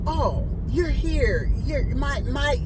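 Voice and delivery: high, slow sugary mock voice; lightly hammed-up high client voice; client mock sweetness. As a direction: sing it out high like a fake-sweet hostess. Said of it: "she was like".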